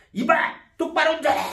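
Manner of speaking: imitating a furious critical parent; angry scolding. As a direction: snap at the driver who braked. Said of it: "승질을 팍 내면서".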